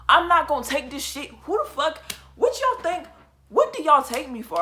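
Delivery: loud, heated refusal, pitch rising; angry arguing. Retold or imitated. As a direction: snap back at the friends, fed up and loud. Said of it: "arguing with them".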